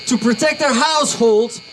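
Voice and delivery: ordinary talk, nothing marked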